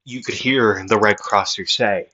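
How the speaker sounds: ordinary delivery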